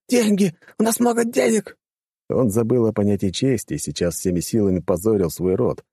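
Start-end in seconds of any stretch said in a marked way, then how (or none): 0.1-1.8 high panicked shriek. A narrator's reading. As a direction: squeal in terror, bargaining. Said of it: "завизжал тот".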